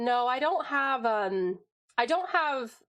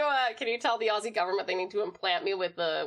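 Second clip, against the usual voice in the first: ordinary talk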